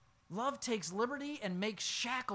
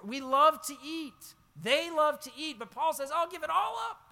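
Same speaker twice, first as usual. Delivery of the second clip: louder and higher, slowing on the refrain; preaching crescendo; 8 semitones higher, 7 dB louder than usual. Acted. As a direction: swell the refrain into a proclamation. Repeated refrain, swelling louder.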